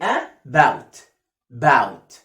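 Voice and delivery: "about" said as a long "baaowt"; open "ow" model. Shown as a demonstration. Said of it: "خد بالك بقي أهو". (نطق "about" بمد آو)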